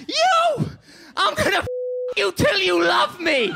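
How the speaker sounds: imitating a furious roaring threat; scary shouting rage. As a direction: bellow the threat at the journalist. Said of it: "this is where it got really scary".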